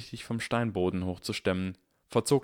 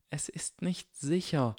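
worried Hermine; 8 semitones higher, slower than usual. Acted. Hermine slow, quiet.

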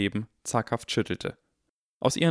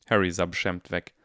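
ordinary delivery, nothing marked

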